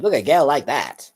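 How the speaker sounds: a bad, hammed-up Mid-Atlantic accent; old Hollywood gangster talk in a Mid-Atlantic accent. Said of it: "the bad Mid-Atlantic accent".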